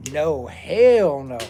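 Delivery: drawn-out, loud hell no; emphatic refusal. Acted. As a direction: stretch every word and lean on hell.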